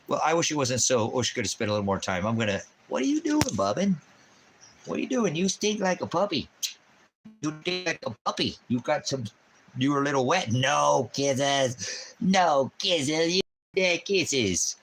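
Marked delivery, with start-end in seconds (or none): 2.9-14.8 high, sing-song pet voice, much higher. Real, not acted. Talking to his wet puppy.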